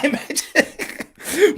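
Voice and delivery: ordinary talk, nothing marked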